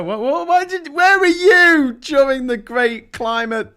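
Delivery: imagined grandchild questioning the vlogger; grandkid asking. Retold or imitated. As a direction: play a reproachful grandchild. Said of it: "easier explain to the, to the grandkids".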